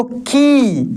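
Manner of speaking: drawn-out की, held vowel; teacher drawing out की slowly; long ee sound. Shown as a demonstration. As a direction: stretch the ई in की out long. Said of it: "उसका उच्चारण खींच कर होगा, लंबा होगा".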